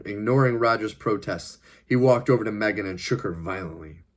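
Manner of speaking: ordinary delivery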